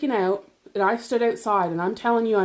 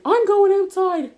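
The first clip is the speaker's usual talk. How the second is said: high, loud mock-hysterical yell; retold screaming; 11 semitones higher, 7 dB louder than usual. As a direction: act out yelling it at him, pitch way up. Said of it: "I'm crying and screaming at him".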